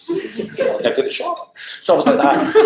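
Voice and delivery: laughing. Real, not acted. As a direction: giggle through it. Laughing at her own cheek.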